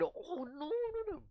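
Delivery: high, soft wail of pretend panic; hammed-up distressed protest; mock dismay. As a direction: whimper it high like a fake outcry. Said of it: "it gets crazy because people are calling".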